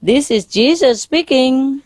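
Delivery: calling down from high up, rising in pitch; mock shout. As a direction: hail someone far below, not truly shouting. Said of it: "So he shouted down at her".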